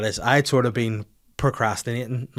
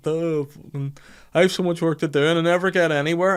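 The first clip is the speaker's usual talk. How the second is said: whiny complaint; 6 semitones higher, 4 dB louder than usual. Retold or imitated. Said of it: "I was like".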